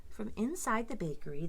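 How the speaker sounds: plain reading voice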